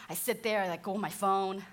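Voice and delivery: ordinary talk, nothing marked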